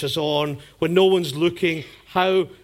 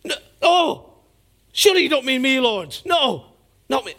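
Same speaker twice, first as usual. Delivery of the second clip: Judas protesting; 8 semitones higher, 3 dB louder than usual. Retold or imitated. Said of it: "he looks at the Lord and he goes".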